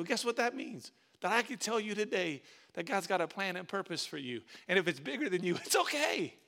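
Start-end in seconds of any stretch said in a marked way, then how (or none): none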